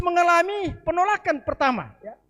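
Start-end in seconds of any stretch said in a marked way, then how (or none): none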